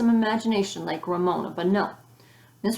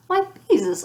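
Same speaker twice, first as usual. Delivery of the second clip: high, louder shocked exclamation; 11 semitones higher, 5 dB louder than usual. A narrator's reading. Teacher shocked that a girl thinks she has no imagination. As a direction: jump up in pitch in genuine dismay. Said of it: "Miss Robin sounded shocked".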